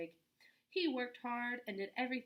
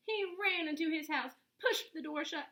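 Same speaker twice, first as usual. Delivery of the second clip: plain reading voice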